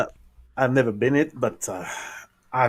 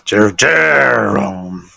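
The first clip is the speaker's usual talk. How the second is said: a very slow French Jerome, much louder; 3 semitones lower, 8 dB louder, much slower than usual. Shown as a demonstration. Player shows the game master his French pirate name. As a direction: draw out Jerome in a French accent. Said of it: "what accent I'll make".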